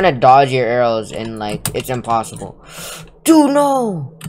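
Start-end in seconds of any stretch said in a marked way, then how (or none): 3.2-4.2 loud, high falling wail; high-pitched dismay. Real, not acted. Gamer protesting a game death to friend, wailing dismay. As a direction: cry out high and let it slide down.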